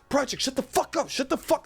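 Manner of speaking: put-on a father begging his kid to shut up, pitched high; hissing dad. Dad on the plane hushing his laughing son, in a high exasperated voice. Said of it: "My dad's".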